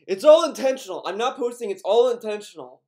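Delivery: loud, overdone mock of the streamer; mocking imitation. Mocking the streamer's post to the viewers, loud and smug. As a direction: hammer the word all like a smug announcement. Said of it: "why would you say it like that".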